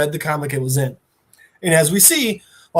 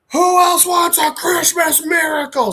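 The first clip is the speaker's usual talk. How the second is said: high, strained mock bellow; acted villain shout; 11 semitones higher than usual. Narrator voices comic villain attacking with a tree, acted shout. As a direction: roar it as a hammed-up rampaging villain.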